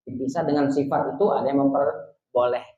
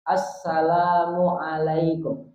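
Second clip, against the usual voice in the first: Assalamualaikum drawn out slowly while facing front; slow facing-forward demo; much slower than usual. Shown as a demonstration. Teacher models the first salam facing qibla. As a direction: hold the greeting slow and steady. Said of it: "Salam yang pertama rukun masih".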